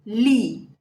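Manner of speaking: ordinary talk, nothing marked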